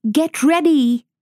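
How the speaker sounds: Mother Wolf warning Father Wolf, urgent and loud. A narrator's reading. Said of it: "said Mother Wolf".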